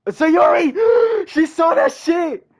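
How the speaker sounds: high, loud, slow horrified yelling; caught-red-handed panic; panicked shout. Real, not acted. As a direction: shout it like you just got caught. Caught by Sayori.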